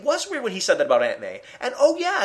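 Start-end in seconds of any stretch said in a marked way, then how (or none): 1.6-2.2 oh yeah thrown out much higher, dawning surprise; a reader who just got it, louder; sudden realization. Retold or imitated.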